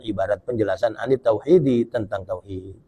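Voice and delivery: ordinary talk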